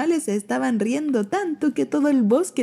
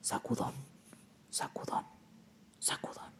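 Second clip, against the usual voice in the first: whispering; 4 semitones lower, 15 dB quieter than usual. A narrator's reading. Story whisper, shaking.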